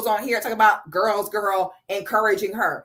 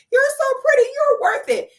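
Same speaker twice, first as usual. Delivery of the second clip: high, louder gushing fake-sweet squeal; mock gushing commenter; 5 dB louder than usual. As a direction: gush over the top like a fawning fan. Mocking fans' gushing comments.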